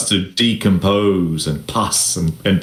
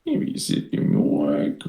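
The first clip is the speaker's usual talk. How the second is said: whispered under the breath; whispered aside; 7 semitones lower, 5 dB quieter than usual. Real, not acted. Game master asking Mike for a second, whispered. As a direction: mutter it to yourself while checking the book. GM mutters while checking rules.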